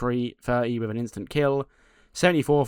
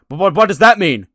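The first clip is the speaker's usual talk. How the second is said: loud, high, rattled shouting; panicked demand; 7 semitones higher, 14 dB louder than usual. Retold or imitated. Johnny yelling after Danhausen, loud and rattled.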